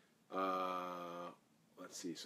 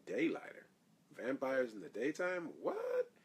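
high, squeaky cries of disbelief; mock disbelief; 9 semitones higher, slower than usual. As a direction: ham up the shocked townsfolk. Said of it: "everybody is just like".

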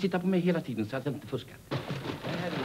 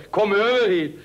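called across the water; come-over-here call, louder; 4 semitones higher, 6 dB louder, slower than usual. Retold or imitated. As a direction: shout it over the lake. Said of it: "ropade påsen till dem".

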